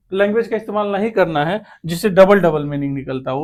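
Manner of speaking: ordinary talk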